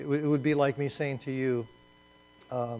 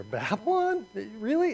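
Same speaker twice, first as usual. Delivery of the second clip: put-on much higher, disbelieving Babylon? Really?; incredulous; 8 semitones higher than usual. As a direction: ask it as if the idea is absurd. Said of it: "that's the feeling here".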